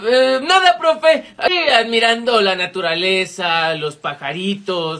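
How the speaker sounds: low, drawn-out, fading excuse; feigned innocence. Acted. Student caught skipping makes excuses to teacher, low sheepish innocence. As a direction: trail down sheepishly, playing the nature lover.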